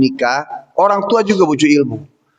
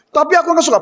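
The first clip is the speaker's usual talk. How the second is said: high, sulky refusal; stubborn daughter; 8 semitones higher, much faster than usual. Retold or imitated. Daughter refusing her father's order, high and sulky. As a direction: dig in like a pouting girl.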